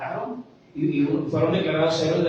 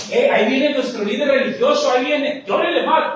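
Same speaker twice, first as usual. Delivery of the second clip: higher, louder, rising to a shouted cue; mock hired mourner; 7 semitones higher, 4 dB louder than usual. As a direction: whip up the fake wailing as the leader arrives. Mourners spot the religious leader arriving. (plañidera burlona que grita)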